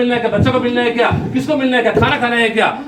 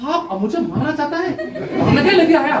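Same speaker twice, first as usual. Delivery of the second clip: retold angry question, pitched higher with a laugh; angry boy; 6 semitones higher than usual. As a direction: playfully act the boy's outrage, half laughing. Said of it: "ये लड़का को इतना गुस्सा आया". (गुस्से में लड़के की हँसते हुए नकल)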